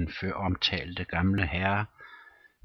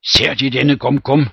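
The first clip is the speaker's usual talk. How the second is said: loud, high, shaken with agitation; agitated question; 6 semitones higher, 12 dB louder than usual. Acted. Rom, shaking, urging the captain to see it. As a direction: ask it trembling with dread. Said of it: "legeme rystede af voldsom bevægelse".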